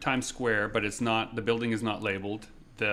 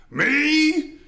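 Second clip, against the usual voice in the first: very high, loud startled me; surprised answer; 10 dB louder than usual. Retold or imitated. Second guy rising from garbage, loud surprised squeak. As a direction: pop up out of the trash and answer.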